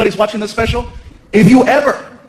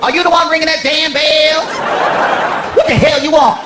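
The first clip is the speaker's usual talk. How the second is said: higher, put-on voice of a grumpy hotel clerk; sassy night clerk; 5 semitones higher than usual. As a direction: snap at the late guest like a fed-up clerk. Rude night clerk lady voiced.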